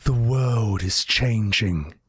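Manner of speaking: slow, low epic movie opening; epic fantasy narrator. Acted. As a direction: intone it like a fantasy prologue. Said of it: "think of the opening of Lord of the Rings".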